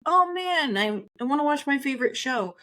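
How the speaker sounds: high-pitched playful protest; imitating your own reluctant self; mock complaint. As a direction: act out a reluctant moan, pitched well up. Voices own earlier reluctance.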